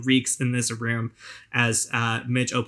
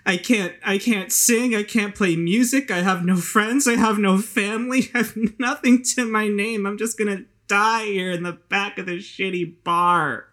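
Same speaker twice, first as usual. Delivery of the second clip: put-on self-pitying drunk woman; 9 semitones higher, 4 dB louder than usual. Hazel wallows in despair.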